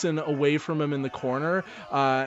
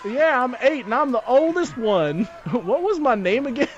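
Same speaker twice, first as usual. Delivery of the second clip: put-on high, loud kid voice; child character voice; 9 semitones higher, 4 dB louder than usual. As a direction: play an excited little kid.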